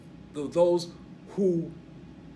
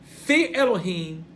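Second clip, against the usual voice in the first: FEAR Elohim, loud and drawn out; forceful, raised "Fear Elohim"; double emphasis; 5 semitones higher, 7 dB louder, slower than usual. Shown as a demonstration. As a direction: hit "Fear Elohim" hard and slow. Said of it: "that's double emphasis".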